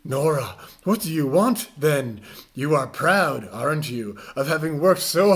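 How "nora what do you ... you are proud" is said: normal talking voice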